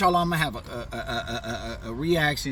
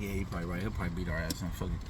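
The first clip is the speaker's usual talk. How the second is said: quiet resigned mutter; 10 semitones lower, 10 dB quieter, faster than usual. Retold or imitated. Giving in to friend, muttering.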